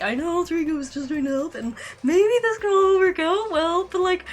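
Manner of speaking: high, loud worried outburst; distressed rant. Real, not acted. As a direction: vent your worry loudly.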